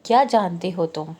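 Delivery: usual speaking voice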